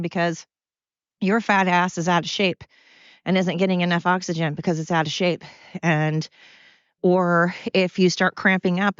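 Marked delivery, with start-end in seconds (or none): none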